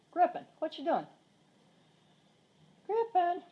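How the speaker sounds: high call to the cat. Real, not acted. Calling the cat.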